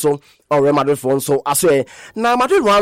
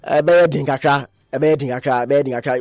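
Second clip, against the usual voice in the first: fading echo; 5 semitones lower than usual. Acted. Phrase said three times, fading.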